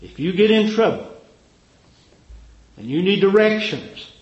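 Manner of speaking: high, loud preaching build. Acted. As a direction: drive it home like a revival preacher. Preaching at full force.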